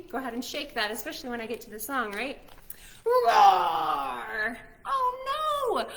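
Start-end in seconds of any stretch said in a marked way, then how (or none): 3.0-4.6 loud playful dinosaur roar. Acted. Dinosaur storms into the room, loud roar. Little dinosaur bursts in roaring. 4.9-5.9 high-pitched oh no; mock alarm. Storyteller pretends to panic at the dinosaur, high mock alarm.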